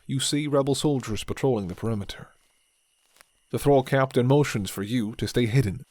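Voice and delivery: usual speaking voice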